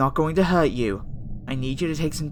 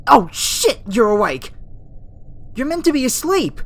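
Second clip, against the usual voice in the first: loud, high-pitched panic; alarmed outburst; 10 semitones higher, 6 dB louder than usual. Acted. Scientist finding the human awake instead of asleep, alarmed. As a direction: blurt it out loudly in startled disbelief.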